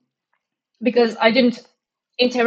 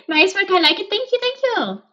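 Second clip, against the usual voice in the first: much higher, squeaky little voice; squeaky thanks; 10 semitones higher than usual. Acted. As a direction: squeak your thanks like a cartoon. Reageert hoog op complimenten.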